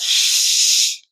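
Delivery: unvoiced shush; playful shush. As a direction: hush the room. Hushing herself.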